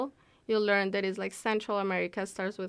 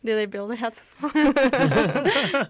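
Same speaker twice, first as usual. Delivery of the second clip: asked through laughter, slow and louder; laughing question; 3 semitones higher, 11 dB louder, slower than usual. Real, not acted. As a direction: tease while laughing. Joking about Panama hats.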